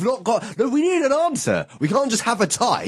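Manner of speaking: high, frustrated pleading; exasperated, voice climbing. Real, not acted. Arguing over a tied vote.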